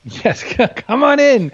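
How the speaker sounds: high, slow, laughing come-on-in; laughing welcome. Acted. Welcoming new depression brethren as a joke, laughing. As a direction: welcome them in through a laugh.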